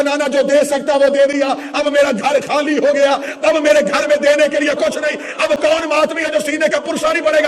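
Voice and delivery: high, loud, anguished declamation; retold tearful plea. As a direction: plead as the grieving grandson, at full voice. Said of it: "رو کے کہا".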